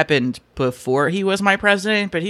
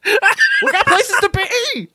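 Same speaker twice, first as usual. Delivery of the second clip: playful yell; 14 semitones higher, much slower than usual. Pretend. Goofy urging bit.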